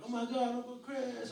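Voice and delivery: high-pitched imitation of excited children; excited little nieces. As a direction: mimic little girls thrilled to see their uncle. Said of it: "excited to see me".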